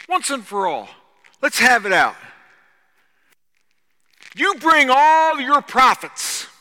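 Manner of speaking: loud, high-pitched prophet's challenge; booming pulpit oratory; full-force preaching. Acted. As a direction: throw down the challenge to a crowd.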